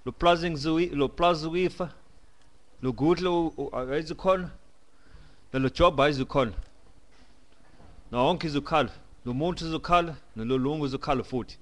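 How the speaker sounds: a few words of Zulu for the viewers; speaking Zulu. Shown as a demonstration. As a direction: speak these lines in Zulu, with a bit of lift. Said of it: "uh, a bit of Zulu".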